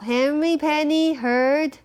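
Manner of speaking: plain reading voice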